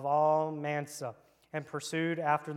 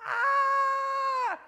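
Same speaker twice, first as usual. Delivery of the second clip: high shrieking scream of fright; demo scream; 6 dB louder than usual. Shown as a demonstration. Preacher showing kids how fleeing Midianites scream, shrill. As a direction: let out a high panicked shriek. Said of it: "I want y'all to go".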